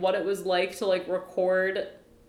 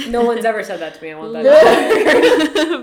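laughing mock delight; 2 semitones higher, 11 dB louder than usual. Playing flattered hopeful comedian.

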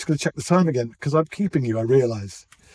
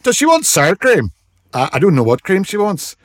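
put-on Dublin accent; 5 semitones higher, 5 dB louder than usual. Voicing Dublin shop assistants.

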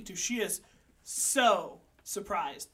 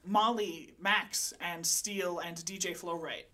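usual speaking voice

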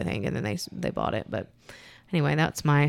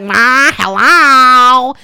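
weird drawn-out hello; 6 semitones higher, 11 dB louder, much slower than usual. Retold or imitated. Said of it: "make weird noises".

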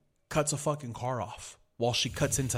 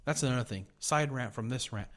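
normal talking voice

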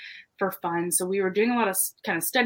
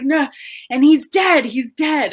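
imitating the friend, higher and much louder; urgent insistence; 7 semitones higher, 8 dB louder, slower than usual. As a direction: press the news on, alarmed. Friend insists the actor died.

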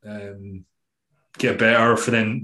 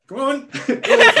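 much higher, laughing "Go on"; excited cheering; 6 dB louder, slower than usual. Retold or imitated. Coach urging his athlete forward, laughing excitedly. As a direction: cheer her on through laughter. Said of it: "part of me wanted to be like".

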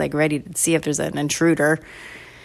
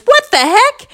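put-on high, loud kid outburst; outraged surprise; 8 dB louder than usual. Sister sees brother with the pink straw, acted outrage. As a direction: exclaim it shrilly, appalled.

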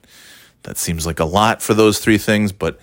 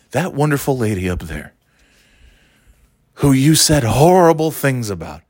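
mildly accusing, pointed delivery; judgmental; 2 semitones higher than usual. Retold or imitated. Cashier judging him about the lady, mildly accusing. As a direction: lightly imitate a disapproving cashier laying on guilt. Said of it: "he almost seems, like, angry at me".

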